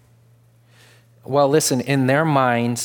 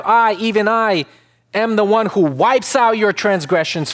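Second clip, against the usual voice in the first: higher, louder, full-force preaching; preacher building to full force; fervent preaching; 7 semitones higher, 3 dB louder than usual. Acted. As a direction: proclaim it from the pulpit.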